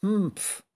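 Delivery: high, short witch's "hmpf"; dismissive huff. A narrator's reading. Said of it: "machte die Hexe". (kurzes, hohes Schnauben)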